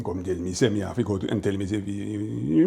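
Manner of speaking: low, grumbling Caribbean dad; muttering father, dropped low and soft; mumbling dad impression. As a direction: mumble the argument to yourself in patois.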